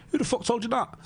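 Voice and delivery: high-pitched, incredulous question; retold startled demand. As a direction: jump up high in mock disbelief. Agent's alarmed reaction, retold.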